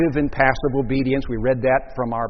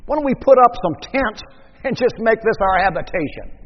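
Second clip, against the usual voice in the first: high, loud, eager outburst with a laugh; Peter blurting out; 8 semitones higher, 4 dB louder than usual. Acted. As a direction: blurt it like an overexcited disciple, easing off towards the end. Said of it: "Peter, again, blurts out".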